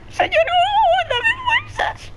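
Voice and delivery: very high, drawn-out squeal for help; desperate wail. Real, not acted. Player begging Goku for strength, desperate wail. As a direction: wail it slowly at the top of your range. Wailing prayer to Goku while fleeing.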